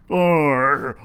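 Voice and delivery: ordinary delivery, nothing marked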